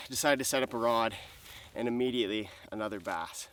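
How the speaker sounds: ordinary delivery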